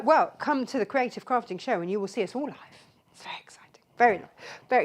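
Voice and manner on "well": normal talking voice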